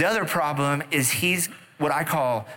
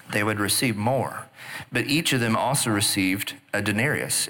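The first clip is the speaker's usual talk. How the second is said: normal talking voice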